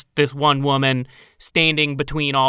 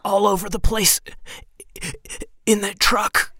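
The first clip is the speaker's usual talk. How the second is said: high, tearful voice; crying; 6 semitones higher than usual. Acted. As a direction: sob it out, shaken. Woman describes the bites.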